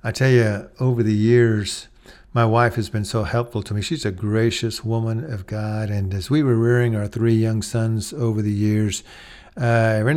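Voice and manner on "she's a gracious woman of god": ordinary talk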